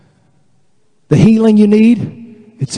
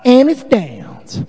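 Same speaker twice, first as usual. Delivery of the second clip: hammed-up slow Southern drawl, pitched high; dumb Southern saying; 6 semitones higher, much slower than usual. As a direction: stretch it out as a mock-stupid Southerner. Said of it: "it sounds even more dumb when we say it".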